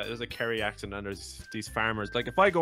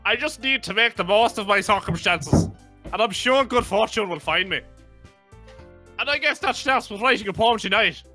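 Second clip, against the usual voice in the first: put-on higher, louder thick Irish accent; 11 semitones higher, 11 dB louder than usual. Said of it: "I just turned my Irish up to ninety".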